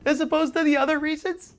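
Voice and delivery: high, loud imitation of her crying; hammed-up high-pitched distraught woman; mock tearful woman. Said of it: "to cry like that".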